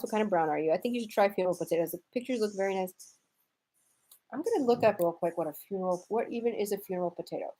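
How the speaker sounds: ordinary talk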